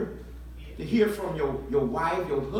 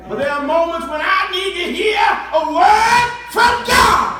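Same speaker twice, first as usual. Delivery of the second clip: much higher and louder, peaking on God; soaring preacher shout; 9 semitones higher, 11 dB louder than usual. Real, not acted. Preacher shouting his need to hear God to the church. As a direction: climb and crack on the last word.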